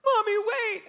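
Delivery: high, loud mock wail; desperate little-kid call, played up; crying child. Son calls through closing door, acted tearful cry. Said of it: "he's crying. He's melting down".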